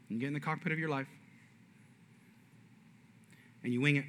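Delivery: soft, low, earnest sermon with long pauses; quiet preaching. Acted. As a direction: bring it home softly to the congregation. Pastor's quiet sermon close.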